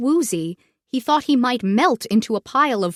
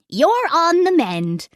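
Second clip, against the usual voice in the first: reassuring; 6 semitones higher than usual. Acted. Caring friend reassuring sick Floyd.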